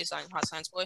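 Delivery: ordinary talk, nothing marked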